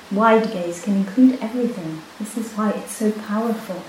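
ordinary delivery

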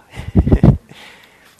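a sudden burst of loud breathy laughter. Real, not acted. Teacher laughing at herself before the students, loud laughter. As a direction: laugh out loud at your own habit. Laughing at own repeated phrase.

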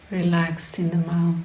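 normal talking voice

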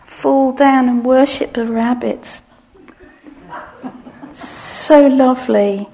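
swooning adoration; adoring gush. Pretend. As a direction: worship the cute creature. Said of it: "look at the nice porcupine".

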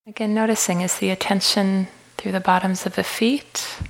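calm, unhurried meditation guide with long pauses; guided meditation. Acted. Leads a walking meditation.

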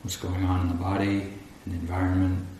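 normal talking voice

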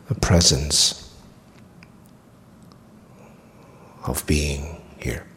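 calm meditation guide with long pauses; guided meditation. Acted. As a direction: guide them gently through the body, leaving long silences.

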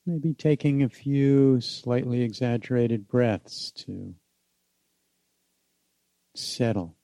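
unhurried meditation guide with long pauses; guided meditation. Acted. Teacher inviting listeners back home in the body, guided meditation.

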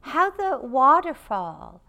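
guided meditation. Acted. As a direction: lead the room gently into stillness.

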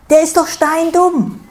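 high, loud spiteful jab; snide. A narrator's reading. Stroh sneering about the stone to Sau, high and spiteful. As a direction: needle it spitefully, pitched high. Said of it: "giftelt Stroh".

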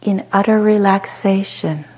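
calm, unhurried meditation guide with long pauses; short soothing phrases, falling gently into silence; guided meditation. Real, not acted. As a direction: guide them into spacious ease, letting each phrase settle.

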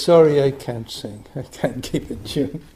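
ordinary delivery

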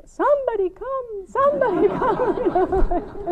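put-on child calling anyone. Child alone in bed calling for anyone, high and wailing. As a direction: plead like a kid, dragging the words. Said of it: "suddenly it was like".

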